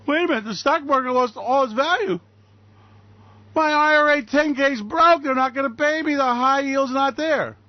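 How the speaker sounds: high, mock-distressed complaint; panicked investor. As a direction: wail like an investor who just lost everything. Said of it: "feel sorry for you when you wake up and go".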